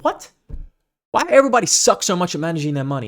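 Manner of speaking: a disbelieving cry at broke coaches; exasperated disbelief. Retold or imitated. Coach recalling his reaction to broke coaches, with exasperated disbelief.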